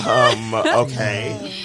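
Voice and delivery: slow, drawn-out imitation of her; stunned hesitation. Woman answers sudden proposal, mock stunned hesitation. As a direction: stretch it out, unsure how to answer.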